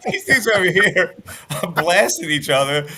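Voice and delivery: loud, high, laughing excitement; laughing excitedly. Real, not acted. Talk show host laughing loudly about linemen blasting each other. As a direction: laugh it up as you hype the big hitters.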